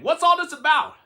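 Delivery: high, loud crowd call; shouting. A narrator's reading. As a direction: call out in amazement. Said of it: "the people came shouting".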